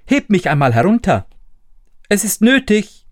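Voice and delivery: put-on high, quick little-boy voice; small high voice of the thumbling; tiny Daumestick. A narrator's reading. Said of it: "Da sprach der Kleine".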